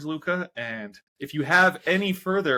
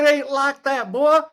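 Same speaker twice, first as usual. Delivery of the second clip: much higher, louder hammed-up Southern twang; mock Texan commenter; 8 dB louder than usual. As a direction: play an indignant Texan correcting you. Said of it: "and they're gonna say".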